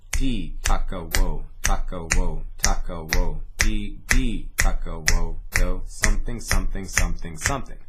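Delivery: loud, punchy didgeridoo rhythm chant. Shown as a demonstration. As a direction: chant it out loud with the snap on each box. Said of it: "So if I snap on every box".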